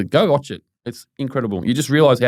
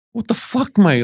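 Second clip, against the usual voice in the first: Jai Patai's stunned outrage; 10 semitones higher, slower than usual. Retold or imitated. Friend imitating the fighter's reaction to a reporter, mock incredulous. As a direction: pitch jumps high in mock disbelief. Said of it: "and he just goes".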